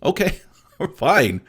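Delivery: slow, amused shrug of a reply; a dismissive chuckle, drawn out; laughing okay fine. Real, not acted. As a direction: brush it off with a laugh. Dismissing the quoted complaint.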